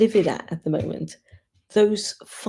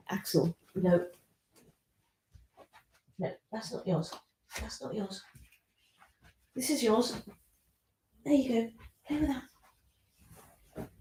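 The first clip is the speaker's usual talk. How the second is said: soft talk to dog; 4 semitones higher, 11 dB quieter than usual. Real, not acted. Gently telling off the dog.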